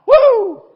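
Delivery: loud high battle whoop. Acted. Preacher whooping like the army's scream. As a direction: let out a big rallying whoop.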